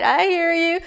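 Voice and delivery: ordinary delivery, nothing marked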